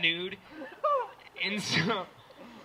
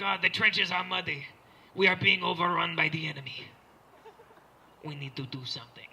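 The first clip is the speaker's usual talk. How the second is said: measured soldier in a fake Swiss accent; understated war-film lament, fake Swiss accent; mock Swiss accent. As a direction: report gravely in a bad Swiss accent. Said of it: "What's a Swiss accent?".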